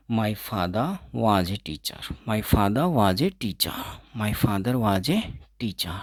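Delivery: "father" said without the r, slowly; r kept silent. Shown as a demonstration. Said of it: "आर को हमेशा silent रखेंगे". ("father" में आर silent, धीरे-धीरे)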